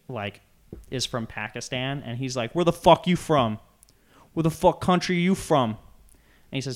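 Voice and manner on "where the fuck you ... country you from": loud, higher mock shout, hammed up; imitated angry yell. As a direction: bark it like the aggressive guy in the video. Retold harassing a store employee.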